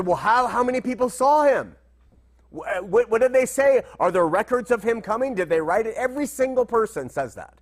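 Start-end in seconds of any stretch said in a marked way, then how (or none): 0.0-5.9 higher, louder mock challenge from a doubter; mocking skeptic. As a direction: throw the skeptic's questions back, hammed-up.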